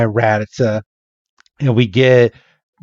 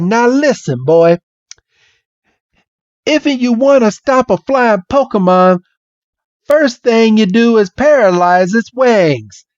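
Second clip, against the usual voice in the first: high Southern lecturing; hammed-up Southern know-it-all; Bill; 9 semitones higher than usual. Bill instructing Red on flying Pokemon, Southern accent. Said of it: "this Southern accent".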